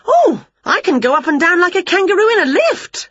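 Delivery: very high, eager witch voice; boastful witch. A narrator's reading. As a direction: brag high, as a keen would-be singer. Winnie boasting of vocal range.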